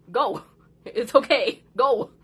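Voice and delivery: loud mock invitation; loud dare. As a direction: call it out, daring him. Telling angry son to leave.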